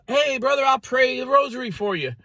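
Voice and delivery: very high, put-on sing-out voice.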